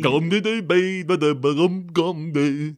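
slow nonsense gulped out; mock gulping speech. Mocking the actor's garbled accent, exaggerated gulping gibberish. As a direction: gulp through gibberish as a mock impression. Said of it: "he's just kinda gulping".

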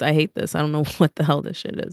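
normal talking voice

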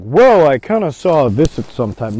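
loud, slow, drawn-out hedging; big woolly mock explanation; mock waffling. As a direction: imitate a ref rambling vaguely. Mocking a vague, wordy explanation.

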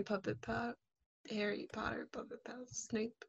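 breathy whispered title. Real, not acted. Recalling an old video, whispered aside. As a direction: whisper it like a secret.

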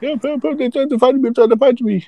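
high, frantic cry for help; panicked man's voice. Retold or imitated. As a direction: squeal it like a scared guy begging for help. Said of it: "that's how he sounded".

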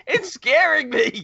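high, slow whine of complaint; hammed-up scared plea; mock frightened protest. Retells own protest to Dave.

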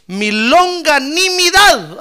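LONGANIMIDAD loud, high and drawn out; proclaimed key word. Acted. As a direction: stretch out longanimidad, ringing and loud. Preacher announces key scripture word. (palabra clave proclamada)

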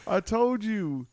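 ordinary delivery

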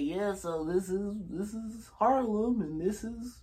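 tour guide voice. Retold or imitated. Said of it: "he was just like".

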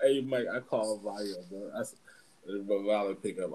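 ordinary delivery

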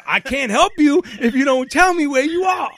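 high, loud, impatient dispatcher voice; exasperated operator. Retold or imitated. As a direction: bark the demand like a fed-up operator. 911 operator losing patience.

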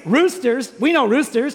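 ordinary delivery

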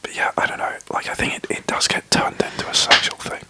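hushed podcast whisper, breathy and close. Real, not acted. Whispers all through podcast.

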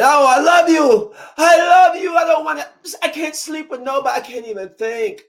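hammed-up lovesick ex pouring it out; passionate declaration. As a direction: overdo the passion, starting at a high pitch. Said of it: "They say it with so much passion".

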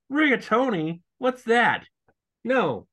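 put-on high, whiny picky-kid voice; picky child. Co-host mocking the villain refusing rigatoni, high and whiny. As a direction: whine like a fussy four-year-old. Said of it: "mocking a picky eater".

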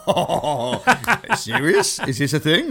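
high, loud mock astonishment; hammed-up incredulous reaction; imitating a manager's surprise. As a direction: act out someone baffled by news, half laughing. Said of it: "And he's like, and he's like".

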